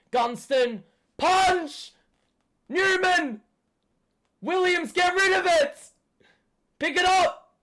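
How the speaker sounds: high, loud barked names; frantic yelling. Real, not acted. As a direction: scream at the players to clear it. Fan yelling instructions.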